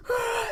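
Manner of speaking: very high, short mock scream; imitated scream. Brother imitating sibling screaming and throwing things, high scream. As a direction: let out a high tantrum shriek. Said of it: "like, screaming".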